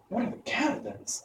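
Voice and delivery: put-on high, soft girlish question; curious girl. A narrator's reading. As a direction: ask it wide-eyed and uneasy. Dorothy asks, high.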